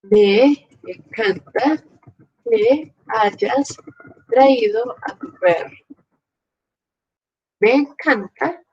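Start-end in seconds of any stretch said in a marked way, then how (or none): 0.0-5.8 very slow, broken into chunks with pauses; slow example reading. Shown as a demonstration. Teacher reads a subjunctive example to students, slow and segmented. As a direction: dictate it piece by piece. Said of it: "Ponemos el acento en la I".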